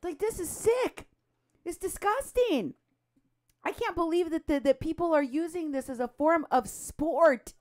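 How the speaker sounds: high-pitched, appalled indignation; outraged disbelief. Real, not acted. Outraged at people filming the danger.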